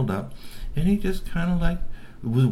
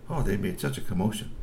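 ordinary delivery

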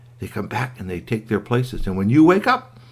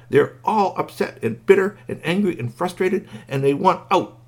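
high, insistent, emphatic outpour; distressed squeaky toy; 10 semitones higher, 4 dB louder than usual. A narrator's reading. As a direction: plead the toys' case in a squeaky voice. Squeaky urgently explains toys' misery.

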